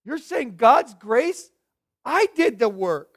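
wife's objection. Retold or imitated. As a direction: push back as the hurt wife who did the work. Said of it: "She said".

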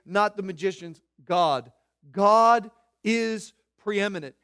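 loud, rhythmic pulpit refrain; preaching at full force. Acted. Preacher thundering God gets the glory, at full force. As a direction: hammer each line home to the congregation.

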